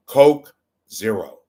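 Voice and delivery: loud, slow impression of an actor; Yul Brynner. Host slipping in his other impression, emphatic actor voice. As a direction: draw out the product name forcefully. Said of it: "That's my other impression".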